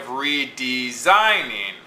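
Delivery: redesigning with SIGN stressed, RE and DE light. Shown as a demonstration. Teacher marking the meter, redesigning with SIGN stressed. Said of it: "Notice it's unstressed".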